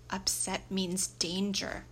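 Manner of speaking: usual speaking voice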